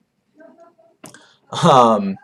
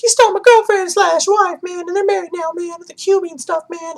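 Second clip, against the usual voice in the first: silly dude voice; 3 dB louder than usual. Retold or imitated. Toby voiced as whiny dude.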